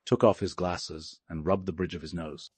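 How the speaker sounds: ordinary delivery, nothing marked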